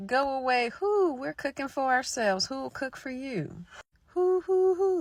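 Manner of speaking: high, loud witch taunt ending in hoots; witches shooing. Acted. Witches ordering the knocker to leave, high and hooting. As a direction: shoo the stranger off like a cackling crone.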